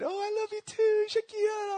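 high, sing-song doting mother; 1 doting mom voice. Retold or imitated. Mother cooing back to her little son, high doting voice. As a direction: coo it like a fond mom. Mom's reply to young son.

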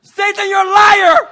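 very high, loud, drawn-out shout; shouted rebuke. Acted. Preacher answering Satan's accusations, shouted rebuke. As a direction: yell the devil down.

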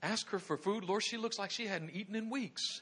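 taken aback, pleading quietly with God; incredulous protest. Acted. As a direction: question the order in hushed disbelief.

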